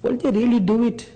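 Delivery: doubtful high question; quoting Moses. Retold or imitated. Preacher voicing Moses doubting. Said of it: "he said".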